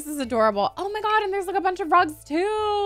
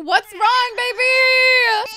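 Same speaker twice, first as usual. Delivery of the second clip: put-on baby talk; 9 semitones higher, 7 dB louder, much slower than usual. Comforting her crying sim.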